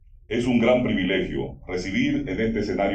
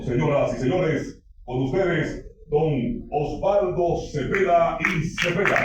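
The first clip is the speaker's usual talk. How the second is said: slow, drawn-out name, higher pitch; grand entrance announcement; 8 semitones higher than usual. Acted. Emcee presents the guest.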